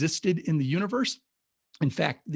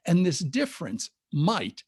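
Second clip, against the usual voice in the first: slow, leaning hard on "might"; stress on might; 3 semitones higher than usual. Shown as a demonstration. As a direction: draw out "might" and land on it. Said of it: "I'm gonna emphasize the word might".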